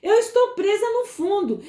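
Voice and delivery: grito desesperado de socorro, bem mais agudo. Retold or imitated. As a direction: pedindo ajuda debaixo d'água, em pânico. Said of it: "E ela queria gritar". (menina afogando grita pela irmã, em pânico)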